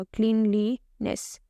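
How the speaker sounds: क्लीनलीनेस slowly as क्लीन, ली, नेस; three separate beats on क्लीनलीनेस; split into syllables. Shown as a demonstration. Teacher confirming three syllables for students, क्लीनलीनेस slowly split. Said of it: "तीन बार touch हुआ".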